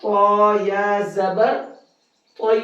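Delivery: slow, level, drawn-out letter spelling with a rise on زبر; measured Qaida drill, pausing before each blended syllable; slow practice recitation. Shown as a demonstration. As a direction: chant each spelling slowly for pupils to repeat. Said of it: "بہت آرام آرام کے ساتھ بھی پڑھنا ہے".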